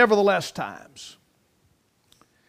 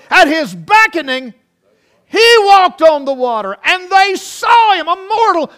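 loud, high, whooping sermon climax; full-force preaching; 8 semitones higher, 11 dB louder than usual. Real, not acted. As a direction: preach it out to the rafters.

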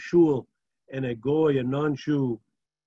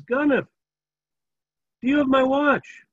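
higher, louder call after the thief; 9 semitones higher, 6 dB louder than usual. Retold or imitated. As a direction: shout down the street at the thief. Rabbi chases the thief.

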